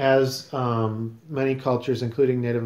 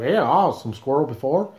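put-on Southern accent. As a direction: imitate a Southern friend in an exaggerated Southern accent. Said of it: "did that come out as Southern".

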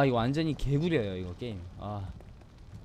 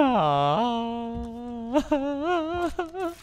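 gleeful laugh; 8 semitones higher than usual. Real, not acted. Laughs after the taunt.

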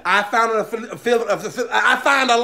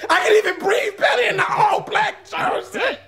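emotional cry-shout; 7 semitones higher than usual. Acted.

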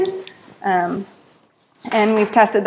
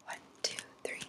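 soft whispered numbers; whispering; 26 dB quieter, faster than usual. Shown as a demonstration. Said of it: "I'll be whispering a few words".

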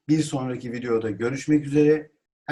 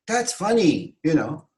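high-pitched amused exclamation; 4 semitones higher than usual. Shown as a demonstration. Teacher models reacting to something funny, exclaimed. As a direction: cry it out brightly, pitched up. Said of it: "ünlem demek haykırmak demek".

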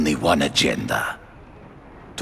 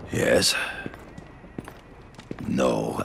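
ordinary talk

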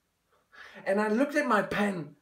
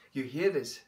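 quiet; 7 semitones lower, 9 dB quieter than usual. Acted. Pleading with his father.

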